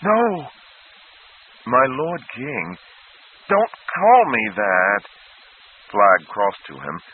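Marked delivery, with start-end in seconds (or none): 0.0-0.5 much higher, desperate no; near scream. A narrator's reading. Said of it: "he nearly screamed". 1.6-5.0 very slow, higher, pleading; boy begging not to be called king; distraught plea. A narrator's reading. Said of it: "distraught boy pleading".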